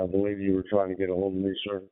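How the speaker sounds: ordinary talk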